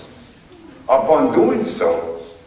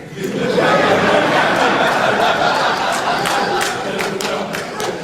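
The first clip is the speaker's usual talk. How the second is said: high airy laugh. Real, not acted. Laughs after retelling his reply.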